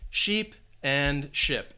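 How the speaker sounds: sheep and ship, slow and separated; long E in sheep, then short I in ship; minimal-pair demo. Shown as a demonstration. Teacher contrasting sheep and ship slowly for learners. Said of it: "you have that short I sound".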